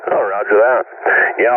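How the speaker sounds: normal talking voice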